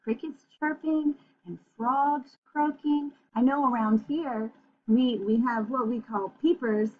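usual speaking voice

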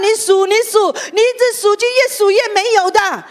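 high, loud, driving sermon voice; fiery preaching. Acted. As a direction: preach it out, pushing the crowd to let go. Preaching at full force. (激昂高亢的讲道)

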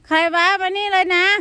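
high, loud yells at an intruder cat; loud startled yelling into the dark; alarmed shouting. Real, not acted. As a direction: shout to scare it off and call her cat.

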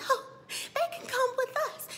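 imitated squeaky, very high invitation; high-pitched offer. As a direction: pitch it way up, mock eager. Acting out Lawrence's offer.

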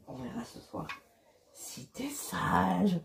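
slow, soft, flirtatious whisper; whispered promise to a lover; teasing whisper. Acted. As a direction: tease your partner in a hush.

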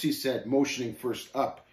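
ordinary delivery, nothing marked

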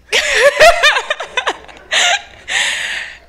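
a long loud laugh; real laughter at the memory; long high laugh. Real, not acted. Laughing about the backhand.

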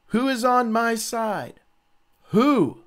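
high, loud, slow call upward. Acted. Jehu calling up to the palace window, high and loud. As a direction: shout up to the window for allies.

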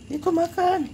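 squeaky high call to a cat; sing-song high coaxing to a cat; high pet call. Real, not acted. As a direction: coax the kitten in a thin high voice. Woman calling stray cats to eat.

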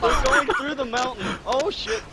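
high shrieking excitement; on a roller coaster. Real, not acted. Vehicles flying through mountain.

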